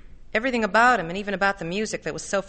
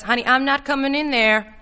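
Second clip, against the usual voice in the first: raised, insistent voice; louder, pressing persuasion; talking over her; 3 semitones higher, 6 dB louder than usual. A narrator's reading. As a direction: push on firmly through the objection. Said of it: "talking right through her voice".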